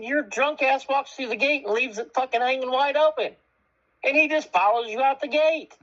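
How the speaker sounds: high-pitched indignant accusation, no shouting; retold heated argument. Man blames drunk neighbor for the open gate, retold heated argument. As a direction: re-enact an exasperated telling-off, pitched high.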